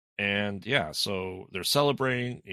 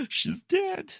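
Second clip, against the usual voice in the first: a gasp, then very high squeaky alarm; mock shock. Family discovers Elise dead, hammed-up shocked squeak.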